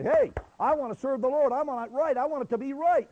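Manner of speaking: high, loud burst of resolve; eager declaration. Acted. Believer stepping out to serve the Lord, loudly resolved. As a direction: call it out with conviction.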